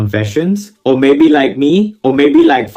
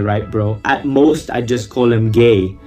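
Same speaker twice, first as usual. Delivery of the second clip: ordinary talk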